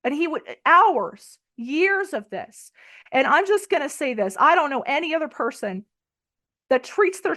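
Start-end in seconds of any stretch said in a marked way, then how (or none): none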